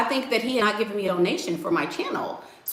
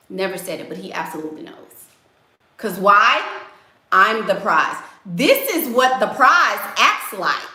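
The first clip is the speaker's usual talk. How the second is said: usual speaking voice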